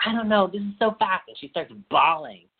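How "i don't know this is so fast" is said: higher, hesitant mock voice of Cassie; mock hesitant girl. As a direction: imitate her unsure and overwhelmed. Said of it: "She's like".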